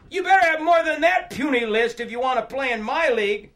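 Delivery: loud, high, scoffing one-upmanship; heckler from the crowd. Acted. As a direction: call out from the back, topping their troubles. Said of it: "hear a voice from far back in the crowd".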